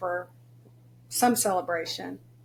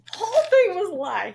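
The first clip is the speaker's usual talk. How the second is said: high, laughing and louder; laughing exclamation; 11 semitones higher, 6 dB louder than usual. Real, not acted. Mom laughing to the host that it was all a lie. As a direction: repeat it cracking up. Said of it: "repeated with laugh".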